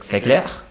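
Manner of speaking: CLAIR rising at the end; question intonation. Shown as a demonstration. Teacher giving an example question, questioning rise on clair. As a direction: ask c'est clair by lifting clair. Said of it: "a question".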